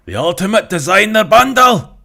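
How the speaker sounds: carried-away West Country hype; West Country shout. Pretend. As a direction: bellow the product name in a broad West Country voice. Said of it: "Oh dear, calm down, Dan".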